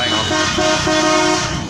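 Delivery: usual speaking voice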